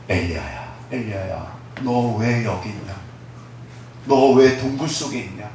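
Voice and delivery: gentle voice of God. Acted. God tenderly asking Elijah why he is in the cave. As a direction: coax him out of the cave softly. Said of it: "부드러운 목소리로, 온화한 목소리로".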